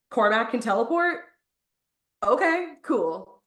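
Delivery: half-joking wow at the twist; mock surprise. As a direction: play dumb amazement, then shrug it off. Mock reaction to plot twist.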